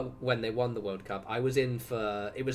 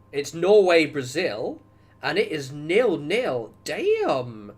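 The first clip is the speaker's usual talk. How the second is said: loud, higher, drawn-out amazement; real excitement; 4 semitones higher, 8 dB louder, much slower than usual. Real, not acted. Streamer checking Norway against Brazil, excited. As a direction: announce the score slowly, amazed. Excited reaction to live match score.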